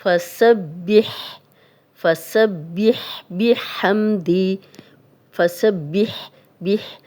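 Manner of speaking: فسبح with a clear breathy ح, slowly; slow tajweed drill; clear ha sound. Shown as a demonstration. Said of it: "ہا کی clear آواز آنی چاہیے".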